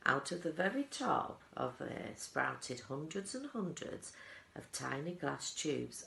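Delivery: ordinary delivery, nothing marked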